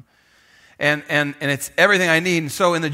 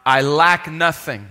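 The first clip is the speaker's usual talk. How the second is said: slow, weighty scripture recitation with the room; leading a church in unison reading; slow congregational recital; 4 semitones higher, 4 dB louder, slower than usual. Real, not acted. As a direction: read it out together, slow and firm. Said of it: "could you say it with me?".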